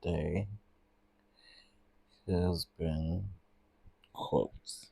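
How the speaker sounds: quietly spelling out the search words one by one; half-muttered while typing. Real, not acted. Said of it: "Let me put that in here".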